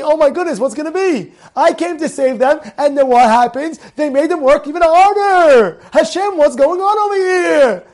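dismayed, high protest building in force; acted distress, rising to a loud appeal; Moshe Rabbeinu. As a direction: plead upward in bewildered complaint. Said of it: "Moshe Rabbeinu was thinking".

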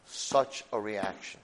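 ordinary talk, nothing marked

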